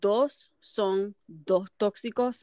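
drawn out, slow; slow and emphatic. Real, not acted. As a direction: stretch each word out.

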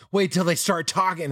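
mock the know-it-all parent with a laugh; smug parent. Imitating smug parents warning him, laughing. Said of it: "They might be like".

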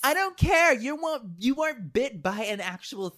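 high, loud, exasperated protest; indignant outburst. Acted. Arguing with friend over who was bitten, indignant. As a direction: shoot it back high and forceful. Heated argument over a snake bite.

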